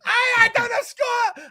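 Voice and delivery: put-on a lightly done Italian accent. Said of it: "I do the Italian accent".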